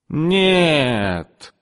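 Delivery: high drawn-out no; shocked refusal. A narrator's reading. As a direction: refuse in disbelief. (высокое протяжное «нет» от шока)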